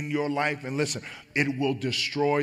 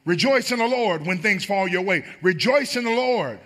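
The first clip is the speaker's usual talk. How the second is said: higher and louder, building refrain; preaching; 6 semitones higher, 4 dB louder than usual. Acted. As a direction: preach each rejoice harder. Preaching at full force.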